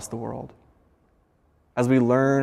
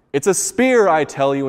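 insisting; 5 semitones higher, 7 dB louder than usual. Acted. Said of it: "insisted the third man".

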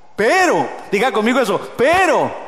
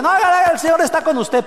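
mock preacher; 8 semitones higher, faster than usual. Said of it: "le dicen a usted".

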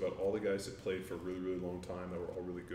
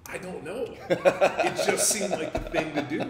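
high, loud, slow laughing excuse; giggling confession to the friend; laughing sheepishly; 10 semitones higher, 12 dB louder, slower than usual. Retold or imitated. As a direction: laugh it off like you can't explain it.

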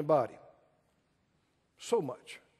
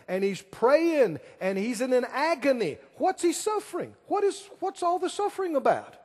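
ordinary talk, nothing marked

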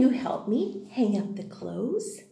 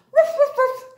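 high, loud playful ruffs; dog barking imitation; 11 semitones higher, 6 dB louder than usual. Teacher showing children what a dog says, high barks. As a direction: bark like a little dog. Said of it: "just like a dog goes".

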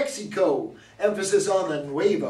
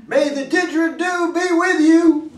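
mock blessing; 9 semitones higher, 8 dB louder than usual. Mock blessing to departing couple.

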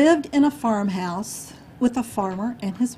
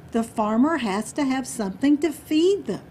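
plain reading voice

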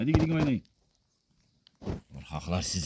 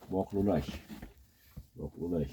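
normal talking voice

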